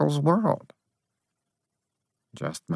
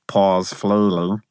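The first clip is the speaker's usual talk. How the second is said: plain reading voice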